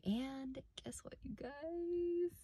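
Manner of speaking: secretive whisper. Real, not acted. Teasing news to viewers.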